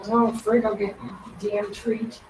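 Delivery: ordinary talk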